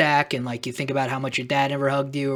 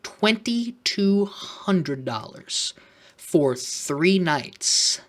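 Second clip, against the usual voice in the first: slow stunned reading; 2 semitones higher, 4 dB quieter, much slower than usual. Real, not acted.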